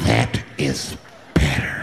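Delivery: very low, slow creep voice; menacing approval. Acted. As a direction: drop deep and draw it out with menace. Same words, low and slow.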